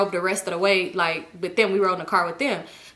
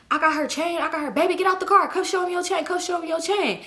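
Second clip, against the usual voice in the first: imitating boyfriend calling out; 8 semitones higher than usual. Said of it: "And he like".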